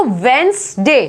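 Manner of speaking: वेंसडे split as "wens, day"; silent d demo. Shown as a demonstration. Teacher repeating the right form, silent d. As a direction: say वेंसडे carefully with no first d. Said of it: "क्या बोलते हैं इसको".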